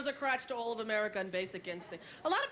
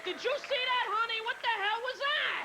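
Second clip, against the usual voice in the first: shocked gossip; 10 semitones higher than usual. Retold or imitated. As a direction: gasp it out like appalled moviegoers. Premiere audience gossiping in shock.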